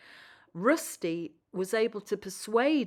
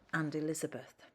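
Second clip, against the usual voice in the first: whisper; 3 semitones lower, 8 dB quieter, faster than usual. A narrator's reading. Storyteller hushes a name.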